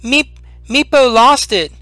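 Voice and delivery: high, stammering little creature voice; a small creature blurting out its loss; distraught kobold. Acted. Kobold Meepo telling the party about the lost dragon, high and stammering. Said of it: "He says".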